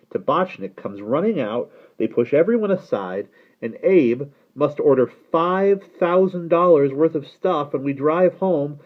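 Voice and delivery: animated, exasperated tirade; aggrieved young man complaining. Acted. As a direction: rattle off the outrageous grievances.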